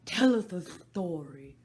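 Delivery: very loud, slow demand; a bossy hare. Acted. March Hare voiced in story.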